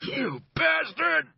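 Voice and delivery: yelled from the dirt, winded and hurt; pained outburst. Acted. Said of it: "His face was contorted in pain".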